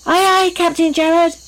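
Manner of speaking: high, keen answer to the captain; spirited storybook sailors' aye-aye; crew's reply. A narrator's reading. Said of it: "they all said".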